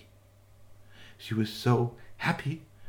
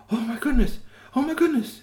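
high, startled oh my goodness; shocked; 13 semitones higher than usual. Acted. Witch seeing the box fall, high and shocked. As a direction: gasp it out, alarmed.

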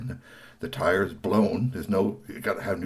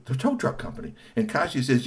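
ordinary delivery

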